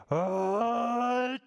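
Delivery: loud, high bear sneeze; big sneeze. Acted. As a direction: let the sneeze burst out.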